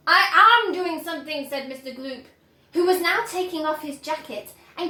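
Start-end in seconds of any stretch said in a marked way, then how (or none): 0.1-1.5 indignant father. A narrator's reading. Mr Gloop retorting.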